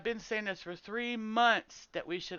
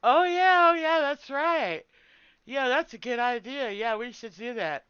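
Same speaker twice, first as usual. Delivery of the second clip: high, loud, slowed fake agreement; mocking eager raiders; 4 semitones higher, 8 dB louder than usual. Streamer mocks raiders agreeing with the guild leader, high and hammy. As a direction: ham up their sudden eager agreement. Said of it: "as soon as the dude says it".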